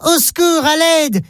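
high, urgent call for help from a window; a child shouting out of a burning building; child crying for help. A narrator's reading. Child at the window calls to passers-by, high and urgent.

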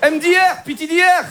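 put-on high Moroccan-accented spelling; letters called out in a comic Moroccan accent; Hicham. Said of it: "l'accent arabe".

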